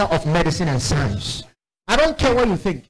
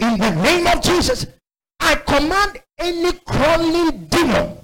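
high, drawn-out preacher's command; exorcism command; 9 semitones higher than usual. Retold or imitated. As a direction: re-enact ordering the demon out, slowly.

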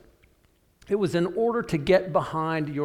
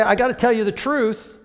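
loud, high, blunt accusation; bold confrontation; 6 semitones higher, 5 dB louder than usual. Retold or imitated. Prophet confronting the king, loud and high. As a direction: call the king out to his face.

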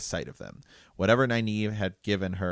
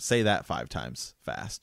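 ordinary talk, nothing marked

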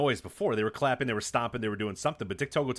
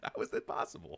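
whispered; 4 semitones higher, 8 dB quieter than usual. Real, not acted. Stunned aside.

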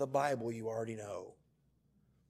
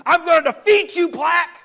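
much higher and louder, hammed-up war cry; mock battle cry; 14 semitones higher, 17 dB louder than usual. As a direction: declare war on plaque like a cartoon hero.

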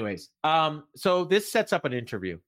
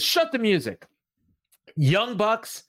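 higher-pitched imitation of a wrestler calling out rivals; promo challenge; 9 semitones higher, 3 dB louder, slower than usual. Wrestler calling out the Young Bucks, imitated promo challenge. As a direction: call them out, mock promo style.

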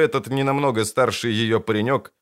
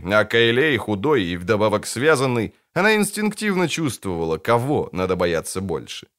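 ordinary delivery, nothing marked

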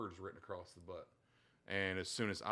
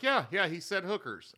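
mock eager daughter; 9 semitones higher, 9 dB louder than usual. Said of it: "she was like".

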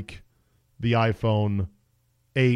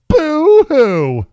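mock sob, high and loud; boo-hoo like a fake crybaby; fake crying; 11 dB louder than usual. Mocking smartphone market's woes.

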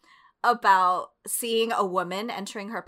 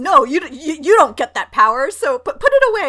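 louder, higher mock reprimand; bossy scolding; 5 semitones higher, 10 dB louder than usual. Society scolding a blossoming girl, louder bossy reprimand. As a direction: lecture her to shut it away. Society ordering girls to shrink.

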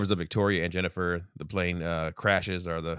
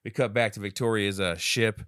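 usual speaking voice

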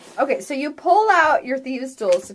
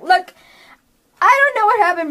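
raised, high-pitched exasperation; exasperated outburst; 8 semitones higher, 5 dB louder, faster than usual. Acted. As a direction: blurt it out, at the end of her patience. Acting mother cat snapping at others.

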